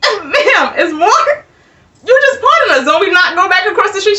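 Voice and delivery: incredulous. Retold or imitated. Speaker asks the clerk in disbelief, high and incredulous.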